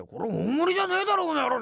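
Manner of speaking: imitating an angry shout at a waiter; sudden angry outburst. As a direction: blow up over the wrong bowl, loud and high. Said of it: "いきなり切れちゃって".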